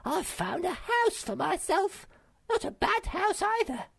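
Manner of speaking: very high monkey voice, calling out; high-pitched creature shout. Acted. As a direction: call the news across in a high squeal of a voice. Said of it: "she shouted".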